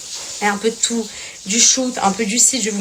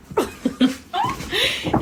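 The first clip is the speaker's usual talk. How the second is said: high giggle; 4 dB quieter than usual. Real, not acted. Laughs over the bags.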